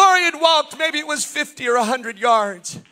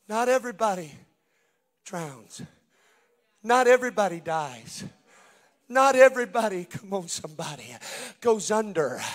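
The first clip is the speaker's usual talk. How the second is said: hushed preaching; 7 semitones lower, 10 dB quieter than usual. Acted. Preacher easing the congregation down, hushed and soft. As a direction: drop to an intimate hush.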